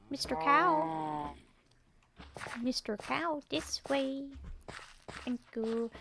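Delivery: high, slow sing-song call. Real, not acted. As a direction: coax the cow gently along. Calling the cow along.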